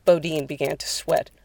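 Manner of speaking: plain reading voice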